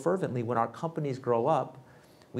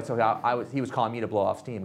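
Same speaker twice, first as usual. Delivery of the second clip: usual speaking voice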